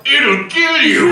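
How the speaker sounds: high, loud, drawn-out warning; big slow movie-line payoff; dramatic follow-up line. Acted. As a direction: stretch it out slowly and loudly. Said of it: "all you hear is the follow-up".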